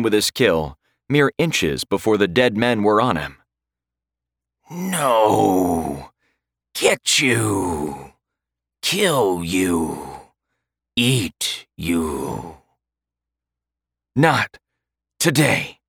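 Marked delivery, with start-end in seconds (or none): 4.7-12.6 undead threats. A narrator's reading. The Draugr threaten Jonathan across the stream, fading creature snarl. 14.1-15.8 huffed. A narrator's reading. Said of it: "Jonathan huffed".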